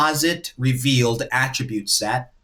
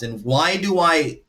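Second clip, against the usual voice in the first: ordinary talk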